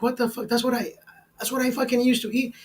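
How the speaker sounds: high, hushed disbelief, then rushing; can't believe what he's hearing; stunned disbelief. Retold or imitated. Dad stunned his toddler eats like him, high incredulous hush.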